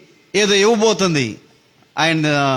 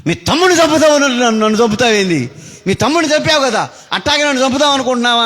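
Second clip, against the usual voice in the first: angry shout; 7 semitones higher, faster than usual. Acted. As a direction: hurl it at the husband in the fight. Wife yells during a quarrel. (భర్తపై కోపంతో అరుపు)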